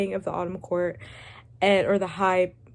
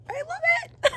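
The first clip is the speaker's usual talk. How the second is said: high giggly laugh; laughing delight. Real, not acted. Reader gushing about her tabbed book, laughing. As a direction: laugh it out giddily.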